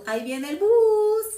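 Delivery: put-on Huila accent. Speaker showing how Huila people stretch words, high Huila accent. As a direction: sing out 'bus' in a long Huila lilt. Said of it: "nosotros los huilenses decimos".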